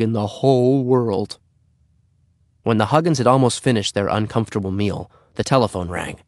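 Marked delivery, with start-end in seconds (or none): none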